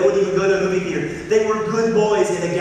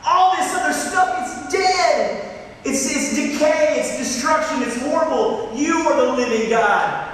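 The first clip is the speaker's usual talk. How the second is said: burn with conviction as you proclaim it; fiery declaration; 9 semitones higher than usual. Acted. Peter answering Jesus, fired up and fervent. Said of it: "you can feel the fire in his bones".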